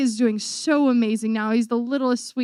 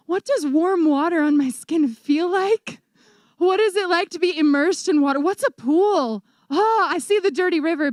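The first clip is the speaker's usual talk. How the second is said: mock longing; 5 semitones higher, 3 dB louder than usual. Acts out longing for bathing comforts.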